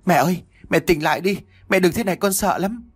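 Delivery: put-on high, urgent child's plea; frightened pleading. A narrator's reading. Long begs his unconscious mother to wake, mild frightened pleading.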